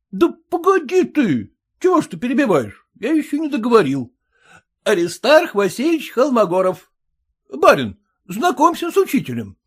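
выше, нетерпеливо; хозяин одёргивает гостя; голос Самоплясова. Acted. (Самоплясов нетерпеливо одёргивает)

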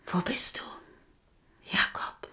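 soft whispered calling. Acted. Queen calling Jakob in the dark, whispering. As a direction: call for him under the breath.

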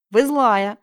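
put-on loud childish accusation; angry girl. Sveta stamping at her teacher, loud angry cry. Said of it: "топнула ногой".

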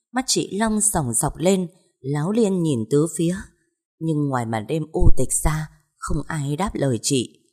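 plain reading voice

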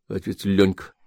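plain reading voice

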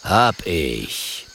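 low panther grumble; grumbling panther. A narrator's reading. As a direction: grumble it like a big cat. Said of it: "brummt der Panther".

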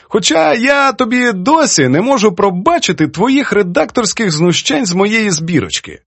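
put-on high-pitched, playful reproach from an elderly poetess; teasing old poetess. Poetess chides her young editor over his edits, teasing.

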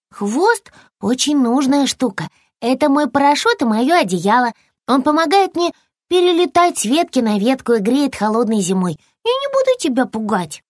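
high-pitched little squirrel, mildly huffy; young squirrel, indignant. Acted. Squirrel explains to lizard his tail's uses, high and huffy.

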